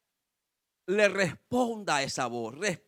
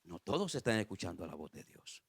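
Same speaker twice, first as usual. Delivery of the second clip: very low, very soft preacher's aside; near-hushed pulpit remark; hushed answer; 12 semitones lower, 13 dB quieter than usual. Real, not acted. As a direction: drop right down for a sobering answer. Answers own question in hushed aside.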